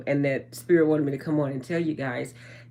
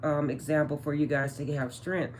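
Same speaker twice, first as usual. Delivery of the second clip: ordinary talk, nothing marked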